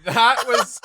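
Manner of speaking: laughing surprise. Real, not acted. Laughing after surprising teaser.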